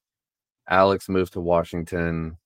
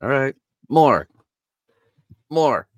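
high, mock-commanding sound guy; bossy sound guy; 8 semitones higher, 5 dB louder than usual. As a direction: order him around like a sound guy. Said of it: "they're like".

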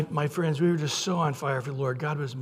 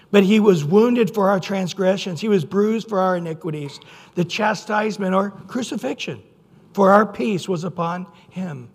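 louder, measured scripture reading; 5 dB louder than usual. Acted. Preacher reading Isaiah to his congregation, proclaiming. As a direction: proclaim the verse to the church.

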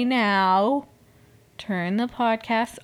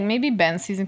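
ordinary talk, nothing marked

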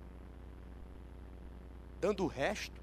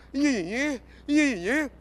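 loud, higher mocking nag; mock whining; 4 semitones higher, 8 dB louder, much slower than usual. Preacher mocking believers who serve for material things, mock whine. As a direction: mimic a nagging whiner, rising at the end. Mimics whiny petitioners nagging for things.

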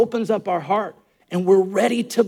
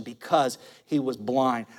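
ordinary talk

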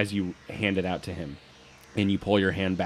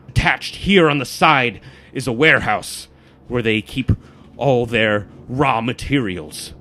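aggressive; 4 semitones higher, 7 dB louder than usual. Acted. Guides the party through the carved map, aggressive. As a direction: drive each point home like a knife stroke. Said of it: "he's carving away".

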